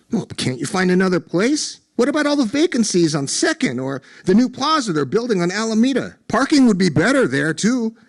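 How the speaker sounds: high, urgent pleading questions. A narrator's reading. Friend begging the owner to relocate, high urgent plea. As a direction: grasp at any way to save it. Desperate pleading to save restaurant.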